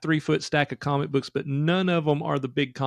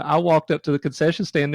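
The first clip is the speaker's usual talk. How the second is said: ordinary talk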